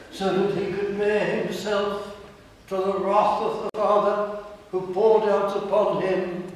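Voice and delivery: rising preaching. Real, not acted. Preacher building to a climax.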